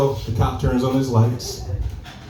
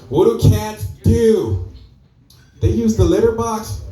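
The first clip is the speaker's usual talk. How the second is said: much higher, slow, puzzled; naive mom; 11 semitones higher, slower than usual. Retold or imitated. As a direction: ask it slowly and innocently. Mom asks about the joke.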